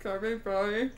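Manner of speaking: words mushed by a full mouth; cheeks stuffed full. Real, not acted. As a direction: mouth crammed with marshmallows.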